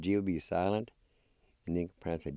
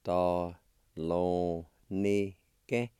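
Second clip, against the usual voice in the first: slow, drawn-out separate syllables; teacher's careful syllable demo; much slower than usual. Shown as a demonstration. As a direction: sound out "da, lo, ni, ke" piece by piece. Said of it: "Second part".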